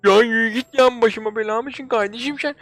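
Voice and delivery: annoyed. Acted. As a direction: shoo off a pest, fed up. Irritated at pestering character.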